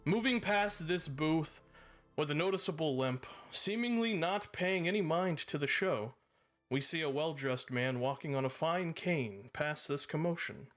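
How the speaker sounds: measured storyteller scene setting; game master narration. A narrator's reading. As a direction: paint the scene for the players.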